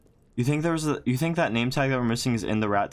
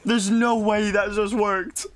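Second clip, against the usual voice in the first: slow, higher amazed wonder; disbelief; 6 semitones higher, slower than usual. Real, not acted. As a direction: marvel at it. Amazed after success.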